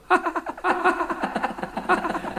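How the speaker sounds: rising chortle. Acted. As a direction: chortle to herself.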